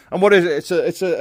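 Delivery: usual speaking voice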